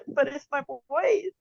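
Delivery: high laughing crying voice; laugh-cry the words. Real, not acted. Laughing lament over her boys.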